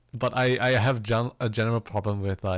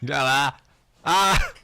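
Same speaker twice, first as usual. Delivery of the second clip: sudden loud frustrated cry, high; frustrated yelp; 5 semitones higher, 8 dB louder than usual. Real, not acted. Streamer failing a tongue twister, loud frustrated yelp.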